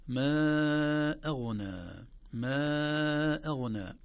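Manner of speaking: ما أغنى chanted slowly with long madd on ما; slow tajweed recitation; Quranic recitation. Shown as a demonstration. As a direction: stretch ما over four beats. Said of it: "المد الجائز المنفصل".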